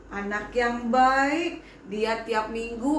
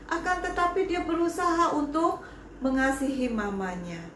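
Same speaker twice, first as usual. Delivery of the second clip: ordinary talk, nothing marked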